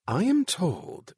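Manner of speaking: Nicole on television. Acted.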